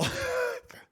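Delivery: sudden high laugh; burst of laughter. Real, not acted. Vlogger cracking up at a cooking clip, laughing.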